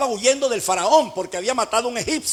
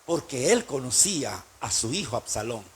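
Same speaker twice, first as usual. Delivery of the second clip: normal talking voice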